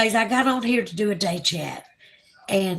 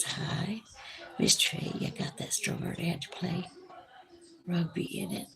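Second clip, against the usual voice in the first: reading quietly; 2 semitones lower, 11 dB quieter than usual. Real, not acted.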